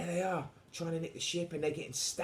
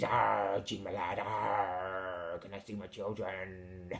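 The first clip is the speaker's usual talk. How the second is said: pirate; 4 semitones lower, much slower than usual. Pretend. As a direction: growl playfully like an old sea dog. Plays a growling pirate.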